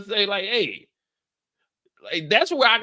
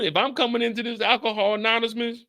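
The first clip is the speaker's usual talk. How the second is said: normal talking voice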